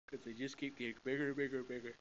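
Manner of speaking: quick run of "bigger", rising; fast building list. Shown as a demonstration. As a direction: rattle off "bigger and bigger". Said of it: "teacher stresses endless growth".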